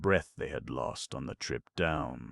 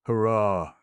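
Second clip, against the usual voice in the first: higher, louder cheer; cheering cry; 6 semitones higher, 9 dB louder than usual. A narrator's reading. Notta cheering Crunch's decision, loud cry. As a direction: cheer him on. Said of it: "cried Notta".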